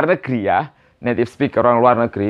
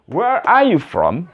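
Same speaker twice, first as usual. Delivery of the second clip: slow, higher-pitched question; model for repetition; 4 semitones higher, slower than usual. Shown as a demonstration. Teacher repeats the question for beginners, slowly and clearly. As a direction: stretch WHERE ARE YOU FROM out.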